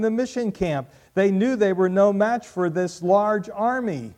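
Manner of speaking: full-voiced pulpit storytelling; preaching at full force. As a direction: build the story to a ringing peak.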